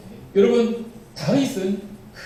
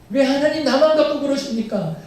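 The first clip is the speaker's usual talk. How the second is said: higher, louder mock wail of protest; louder whining complaint; 7 semitones higher, 4 dB louder than usual. Believers protesting to God, hammed-up higher whine. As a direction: push the grievance further, hammed-up.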